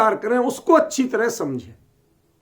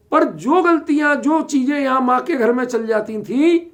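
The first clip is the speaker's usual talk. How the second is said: usual speaking voice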